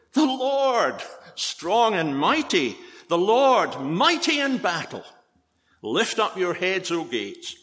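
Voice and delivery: solemn scripture reading. Acted. Preacher declaiming the psalm to his congregation, ringing and lifted. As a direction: proclaim the psalm to the gates, rising on each line.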